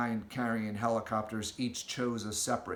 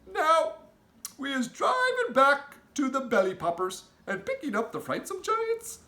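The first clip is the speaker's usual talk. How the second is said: a big gentle giant with odd grammar; friendly giant; 12 semitones higher, 5 dB louder than usual. Acted. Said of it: "the B F G said".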